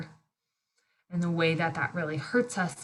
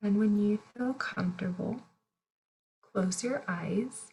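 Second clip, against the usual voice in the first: guided meditation. Real, not acted. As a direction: guide them gently through each breath, unhurried. Leads a breathing meditation.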